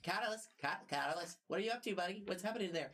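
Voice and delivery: high, quick telepathic bird voice; chirpy bird creature in your head; bird person voice. Acted. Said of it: "bird person voice inside of your head".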